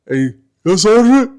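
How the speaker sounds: distant soldier's reply, rising high and loud; Chavez. Retold or imitated. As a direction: imitate a simple private calling back from afar. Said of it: "he's way down at the end".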